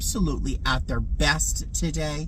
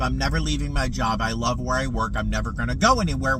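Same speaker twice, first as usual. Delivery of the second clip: ordinary talk, nothing marked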